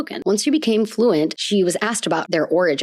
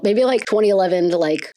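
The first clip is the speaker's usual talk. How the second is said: ordinary talk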